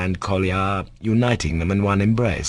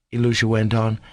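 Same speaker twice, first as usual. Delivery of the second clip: ordinary delivery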